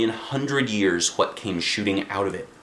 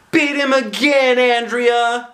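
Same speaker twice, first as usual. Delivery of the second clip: very high, louder, slow cheer; shouted cheer; 13 semitones higher, 6 dB louder, slower than usual. A narrator's reading. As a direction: cheer for a classmate. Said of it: "shouted one of the girls".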